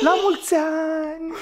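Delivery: high, sung-out celebratory call; festive cheer. Acted. Man toasts his lover on their anniversary, festive cheer.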